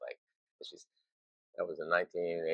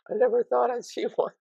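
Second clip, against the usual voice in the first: high, tearful-happy gush with a laugh; awed fan gushing; 6 dB louder than usual. Retold or imitated. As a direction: imitate a moved fan seeing a koala. Women crying over koalas, quoted.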